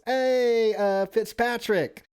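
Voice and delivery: high, loud mock-friendly greeting, slow on the name; guy at the wedding. Wedding guest greets the speaker at the bar, high loud mock call. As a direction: hail him like a tipsy wedding guest who thinks he knows you. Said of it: "He goes".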